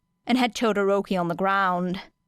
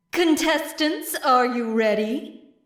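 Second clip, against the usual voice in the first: referee call; 4 semitones higher than usual. Acted. Referee Midnight calls to contestants.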